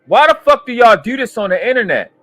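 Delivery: high, loud, exasperated yelling; furious shout. Real, not acted. Reacting to the clip, furious high-pitched shouting. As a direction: yell at him like he's lost his mind. Enraged rant at clip of man.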